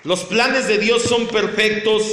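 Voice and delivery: loud, high, slow proclamation; forceful preaching. Acted. Pastor declares God's plans perfect and eternal, forceful preaching. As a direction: declare each phrase slowly at full voice.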